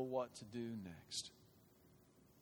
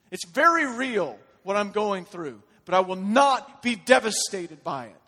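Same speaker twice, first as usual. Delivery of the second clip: loud, high, impassioned declaration; full-force preaching; 10 semitones higher, 14 dB louder than usual. Real, not acted. Preacher voicing a believer standing on bedrock, full-force preaching. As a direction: proclaim defiant faith at full voice.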